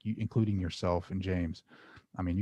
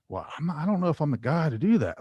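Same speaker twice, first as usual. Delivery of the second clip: higher, louder, quick self-doubting objection; reluctant protest; 7 semitones higher, 5 dB louder, faster than usual. Retold or imitated. Meditator telling God he doubts himself, protesting. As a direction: retell a hurried, unsure protest.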